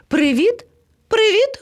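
high, rising greeting calls; calling out. A narrator's reading. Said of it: "гукнув до них". (гукати високим голосом)

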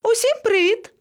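very high, singsong hello; high rising greeting; cheery greeting call. A narrator's reading. Monkey calls greeting from above.

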